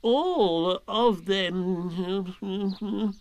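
slow, sulky and drawn out; a sulky, huffy owl; sulkily. Acted. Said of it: "said Owl sulkily".

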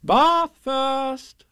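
high, loud motherly sing-out; cheery mother, pitched high; cheerful. A narrator's reading. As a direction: announce it cheerily to a small child. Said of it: "said Kanga in a cheerful voice".

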